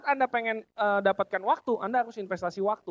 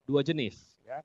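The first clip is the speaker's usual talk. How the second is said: usual speaking voice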